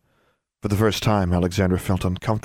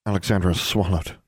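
ordinary delivery

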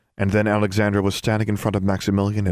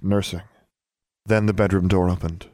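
plain reading voice

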